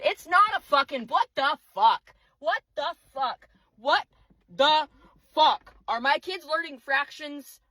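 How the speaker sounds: high, loud exasperated rant; really mad. Real, not acted. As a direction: break it into separate, incredulous words as the anger builds. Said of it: "I'm so fucking mad".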